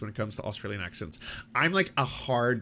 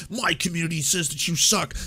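raised, taunting mock crowd voice; mock fan taunt; 4 semitones higher, 3 dB louder than usual. One fan base jeering at the other, mock taunt. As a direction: jeer like a rival fan, playfully. Acting out two feuding fan bases.

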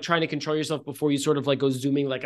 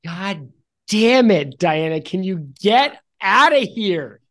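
exasperated outburst; 4 semitones higher, 7 dB louder than usual. Real, not acted. Game master scolds a heckling player, loud and exasperated. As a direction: snap loudly at a heckler.

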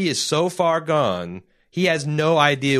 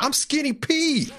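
high-pitched cocky bravado; Skinny Pete. Acted. Podcaster imitating a comic scumbag character's bravado, cocky and high. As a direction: crow it like a swaggering small-time stoner. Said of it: "from his bravado, that's like".

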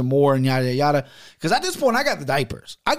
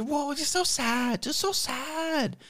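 much higher, softer, slow whiny mock pity; mocking haters; 12 semitones higher, 7 dB quieter, much slower than usual. Mocking the negative commenters, high, slow and whiny. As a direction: whine it like a fake-sad troll. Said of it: "it's like".

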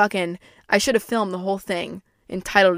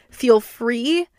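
ordinary talk

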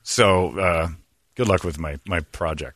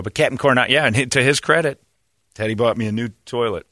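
normal talking voice

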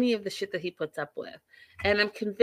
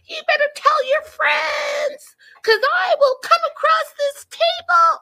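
screeching mock tirade, very high and loud; hammed-up high-pitched screaming rage; Angela; 8 dB louder than usual. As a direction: shriek it like an outraged diva.

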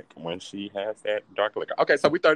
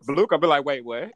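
laughing; 5 semitones higher, 4 dB louder than usual. Pretend. Laughing through quote.

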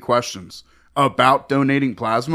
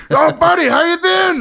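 overeager greeting; 6 dB louder than usual. Retold or imitated. Guy greets him overeagerly.

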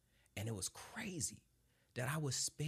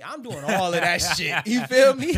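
laughing; 9 semitones higher, 20 dB louder than usual. Real, not acted. Laughing while bragging on podcast.

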